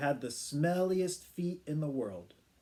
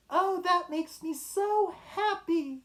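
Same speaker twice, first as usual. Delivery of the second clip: happy woman; 13 semitones higher, 3 dB louder, slower than usual. Acted. Woman voiced, delighted reply.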